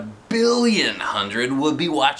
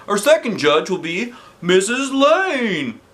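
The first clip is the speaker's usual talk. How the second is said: high, bright showman's welcome; announcer voice; 7 semitones higher, 3 dB louder than usual. Acted. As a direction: announce it to the crowd. Principal announcing on stage.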